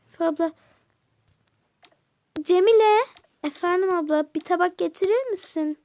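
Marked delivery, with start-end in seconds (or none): 2.4-3.0 louder, higher call of the name; loud summoning call. Acted. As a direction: call her from across the house. Aunt calls the girl.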